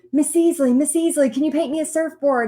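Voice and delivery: mimicked schoolkid begging a favor; eager kid pleading. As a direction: pester the teacher like an excited kid. Kids pestering their art teacher.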